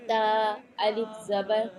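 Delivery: slow chanted letter-by-letter recitation; chanted Quran-primer practice; qaida recitation. Acted. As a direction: intone each letter slowly, like a lesson drill.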